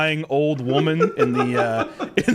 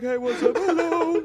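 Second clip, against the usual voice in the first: mock high greeting; 13 semitones higher than usual. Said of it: "I'm like".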